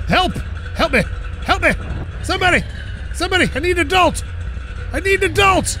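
high playful yells for help; mock cry for help. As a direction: call out like a stranded kid. Stuck truck, mock cry for help.

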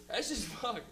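ordinary delivery

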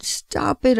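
slow, voiced, drawn-out begging; slow crying stop it, after the whisper; crying plea. A narrator's reading. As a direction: stretch the words out slowly, pleading. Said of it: "I cried".

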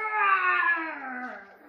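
long loud high roar; playful lion roar. Acted. Boy pretending to be a lion, playful roar. Sister roars too.

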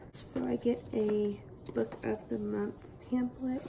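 soft, half-whispered chat; hushed morning voice. Real, not acted. Unboxing a book package early morning, hushed. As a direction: keep it hushed so nobody wakes.

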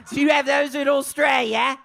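high-pitched, hammed-up Australian accent. Shown as a demonstration. Comic asking about candy in Australia, hammed-up Australian accent. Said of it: "That's my Australian accent".

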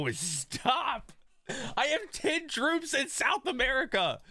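high-pitched outraged yelling; shaking with anger. Real, not acted. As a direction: squeal in furious disbelief. Friend's move ruins god spawn.

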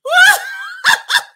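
high, loud shriek of laughter; real piercing laugh. Real, not acted. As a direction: let out a squealing cackle. Big laugh on stream.

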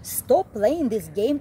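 usual speaking voice

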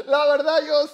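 high laughing burst. Real, not acted. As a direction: laugh it out, real amusement. Laughs at friend's refusal. (risa de verdad, aguda)

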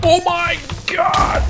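very high cry of fright; startled shriek. Real, not acted. Player scared by an Enderman's sound, shrieking. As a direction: shriek it out, suddenly high-pitched.

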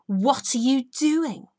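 loud, slow furious scream; Lady Boulder catching the girl by the cage. A narrator's reading. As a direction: scream it at the intruder. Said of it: "screamed the woman".

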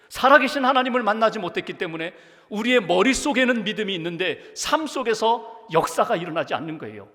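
loud, high, driving sermon delivery; fervent preaching. Acted. Pastor urging the congregation to trust God, preaching at full force. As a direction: preach it hard to the pews.

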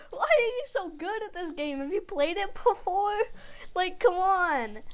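high, exasperated whine; whiny complaint. Real, not acted. Losing player to the better opponent, whiny complaint. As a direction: protest the unfairness in a high voice.

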